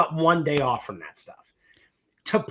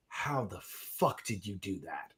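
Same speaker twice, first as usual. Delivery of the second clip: stunned hush; 8 semitones lower, 14 dB quieter, slower than usual. Retold or imitated. Producer stunned by the tiny budget.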